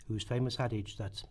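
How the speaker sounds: usual speaking voice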